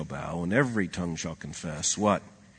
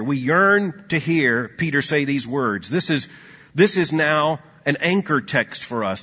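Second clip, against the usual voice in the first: higher and louder, some words slowed; fervent preaching; 5 semitones higher, 6 dB louder than usual. Acted. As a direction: preach it with yearning. Climactic preaching.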